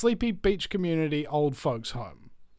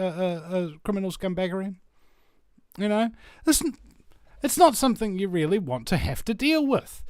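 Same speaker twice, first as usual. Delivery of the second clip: ordinary delivery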